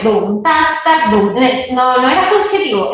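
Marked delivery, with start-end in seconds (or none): 0.0-1.4 vocal drum pattern; beatboxed rhythm. Shown as a demonstration. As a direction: imitate the drum beat, dum low and tac sharp. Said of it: "tú escuchabas claramente". (ritmo imitado con la voz, dum grave y tac seco)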